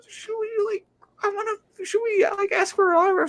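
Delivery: high, squeaky excited whisper; starstruck whispering. Retold or imitated. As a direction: imitate a nervous fangirl's hushed squeal. Voicing starstruck friend beside sister.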